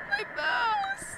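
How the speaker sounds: very high squeaky cry; squeal. Real, not acted. Gamer squeaking in alarm about her mouse.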